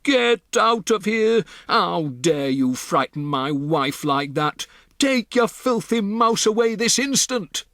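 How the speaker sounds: towering over them, ordering them out; furious shouting. Acted.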